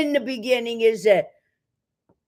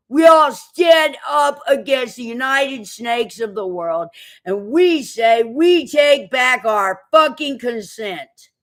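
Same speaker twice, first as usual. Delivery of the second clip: loud defiant shout; rally the crowd against them; furious public declaration; 3 semitones higher, 4 dB louder than usual. Real, not acted. Rallying cry against the powers.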